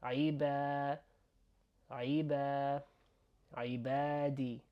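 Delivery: "عيبا" with "ba" held long; stretched A. Shown as a demonstration. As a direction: draw out the a in "عبادي". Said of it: "we're stretching the A sound".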